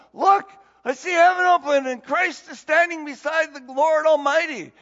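high, loud proclamation; Stephen crying out. Retold or imitated. Stephen crying out to the stoning crowd, loud and high. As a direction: cry it out to the angry crowd.

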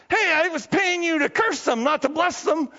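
high, indignant acted complaint; upset Balak. Balak complains to the prophet he is paying, upset. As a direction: protest like a cheated employer. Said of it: "He's all upset".

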